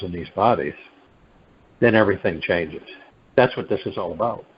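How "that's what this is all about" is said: said quickly, running the words together; quick passing statement. Real, not acted. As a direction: state it briskly in one run. First saying, then repeated for emphasis.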